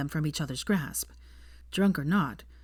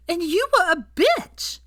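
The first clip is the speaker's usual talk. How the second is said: put-on drunk girl voice; 13 semitones higher, 6 dB louder, slower than usual. A narrator's reading.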